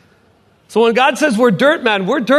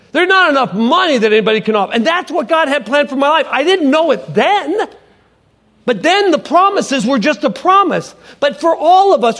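preaching at full force; 5 semitones higher than usual. Acted. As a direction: drive the message home with fervor. Preaching climax.

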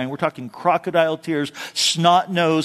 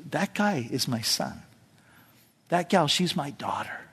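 tender father; 7 dB quieter than usual. Retold or imitated. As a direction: speak of your own child. God speaking lovingly.

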